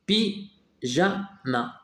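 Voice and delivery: "Pyjama" very slowly as py, ja, ma; three detached beats on "Pyjama", gaps between them; split into syllables. Shown as a demonstration. Teacher finding the syllable with G, syllable by syllable. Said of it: "Entoure la syllabe où tu vois G".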